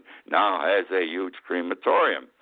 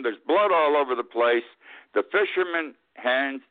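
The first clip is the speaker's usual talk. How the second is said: normal talking voice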